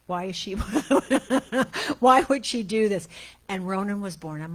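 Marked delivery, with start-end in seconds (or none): 0.1-0.5 mock complaint. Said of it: "jumping up and down". 0.6-1.9 laugh. Real, not acted. Laugh mid quote. 2.0-3.1 mock complaint. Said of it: "jumping up and down".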